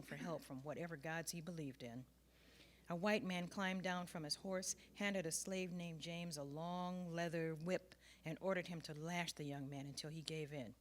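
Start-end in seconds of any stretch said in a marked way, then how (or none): none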